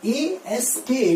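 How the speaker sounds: slow letter-by-letter spelling; spelled out. Shown as a demonstration. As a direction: spell out each letter slowly. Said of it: "That means". (अक्षर-अक्षर धीमी spelling)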